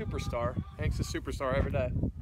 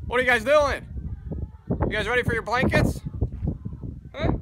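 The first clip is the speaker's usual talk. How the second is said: calling out; 8 semitones higher, 5 dB louder than usual. Real, not acted. Calling to the ponies.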